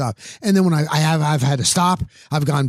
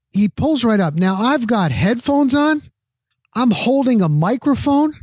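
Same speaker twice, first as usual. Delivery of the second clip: indignant; 6 semitones higher than usual. Real, not acted. Outraged at rude truck driver.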